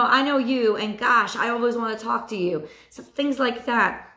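ordinary talk

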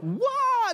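high, drawn-out whoa; sudden high-pitched mock holler; imitated flying-kid yell. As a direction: mimic a child launching through the air. Acts a kid leaping off stairs.

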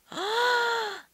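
sharp high gasp of wonder; admiring gasp. Shown as a demonstration. Teacher demos admiration with a high gasp. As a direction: gasp in amazement. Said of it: "De admiração".

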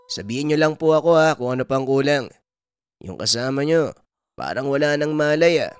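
put-on young helper's voice, rather forceful; Norman, eager grandson. Grandson brings the healer what she needs, urgent.

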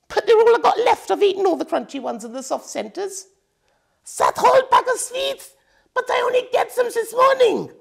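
high loud witch whine; whining witch. A narrator's reading. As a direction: plead your case like a greedy child. Witch voiced, defending herself.

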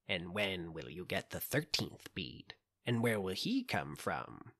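low, soft witch questioning. A narrator's reading. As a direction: murmur it like an old witch.

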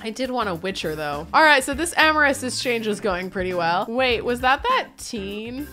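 ordinary talk, nothing marked

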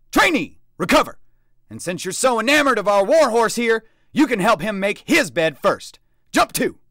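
loud, very high commands; barked orders. A narrator's reading. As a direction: crack out the orders. Sergeant barks orders.

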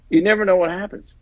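ordinary talk

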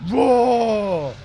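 loud playful dinosaur roar; mock roar. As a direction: roar like the Rex, hammed-up. Roaring as the Rex.

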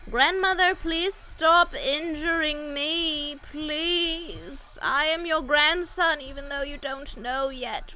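high, drawn-out, hammed-up begging; mock pleading. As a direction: beg grandmother theatrically, stretching the please. Pleading with grandmother boss in game.

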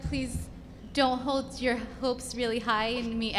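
ordinary delivery

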